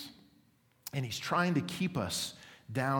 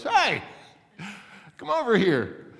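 very high, loud parrot screech; squawking parrot hail; 9 semitones higher, 7 dB louder than usual. Acted. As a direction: holler like a pushy parrot. Parrot hails customer a third time.

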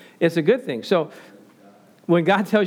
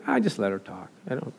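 calm coworker voice; 5 semitones lower than usual. Retold or imitated. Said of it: "You know what he says".

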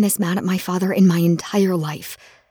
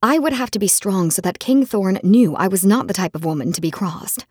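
usual speaking voice

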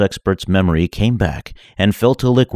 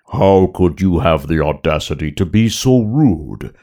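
big booming Hutt voice; Jabba voice. Acted. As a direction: rumble like a giant slug boss. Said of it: "a deep second sound".

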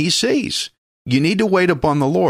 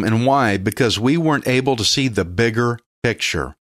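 ordinary delivery

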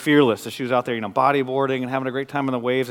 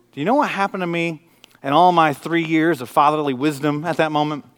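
normal talking voice

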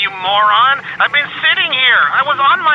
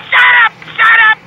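angry shout; 7 semitones higher, 6 dB louder, much slower than usual. Acted. [name] yelling shut up.

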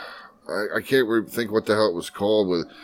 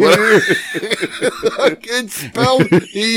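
laughing; 12 semitones higher than usual. Real, not acted. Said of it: "laughing through high voice".

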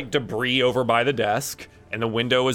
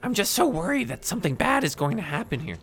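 higher-pitched, worried professor; professor's voice, pitched up; 7 semitones higher, faster than usual. Acted. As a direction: play a grateful but worried academic. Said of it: "And the professor says".

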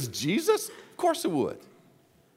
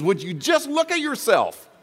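Jesus rebuking; 13 semitones higher, 7 dB louder than usual. Retold or imitated. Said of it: "Jesus says".

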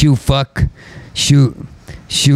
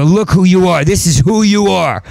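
yelling; 6 semitones higher than usual. Retold or imitated. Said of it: "I yell, I, I go".

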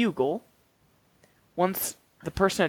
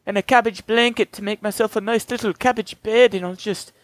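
higher, louder mock Irish farmer; Irish brogue; 5 semitones higher, 5 dB louder than usual. Conor the farmer guarding his cabbages, in a hammed-up Irish accent. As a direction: ham up a stage Irish accent. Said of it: "[name] here".

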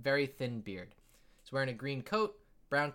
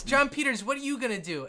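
put-on loud, high kid's challenge; kid game master; 10 semitones higher, 4 dB louder than usual. Kid game master asking John Peters to act, high call. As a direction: call out the player in a child's pitched-up voice.

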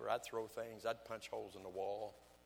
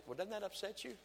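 wife's question; 10 semitones higher than usual. Retold or imitated. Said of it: "my wife will say".

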